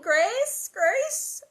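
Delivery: high, loud call from the doorway. A narrator's reading. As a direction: call for the baby.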